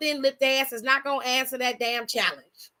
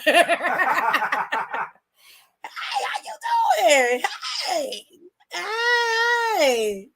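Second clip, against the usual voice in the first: laughing greeting; 3 semitones higher, much slower than usual. Real, not acted. Greeting new viewer, laughing.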